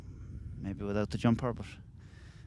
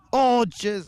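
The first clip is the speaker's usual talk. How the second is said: shocked; 10 semitones higher, 6 dB louder than usual. Real, not acted. Reacting to a hard tackle.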